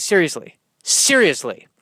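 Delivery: normal talking voice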